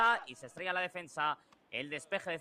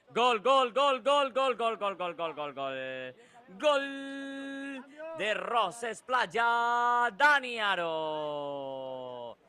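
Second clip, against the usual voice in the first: long stretched goal scream; breakneck goal commentary; 5 semitones higher, 3 dB louder, much slower than usual. Real, not acted. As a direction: roar out the goal call, holding it. Goal scored, long goal call.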